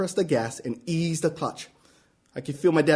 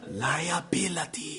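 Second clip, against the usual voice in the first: much softer and slow, squinting; quiet, drawn-out; 3 semitones lower, 11 dB quieter, much slower than usual. Retold or imitated. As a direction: say it slow and menacing, barely above breath. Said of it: "he says again".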